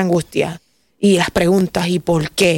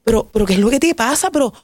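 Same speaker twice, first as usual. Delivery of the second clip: high, rising disbelief at herself; self-reproach; 6 semitones higher than usual. Retold or imitated. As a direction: scold yourself in shocked disbelief. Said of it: "Y yo decía". (reproche a sí misma, incrédula y aguda)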